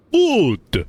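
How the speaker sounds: high, loud gleeful bark; cheery exclamation. Acted. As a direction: fire it back with glee. Leo cheerfully retorts.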